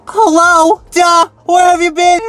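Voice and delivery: high, loud, eye-rolling duh; sassy hello. Acted. Vlogger defending being judgmental, loud and sassy. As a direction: toss it off with mock sass.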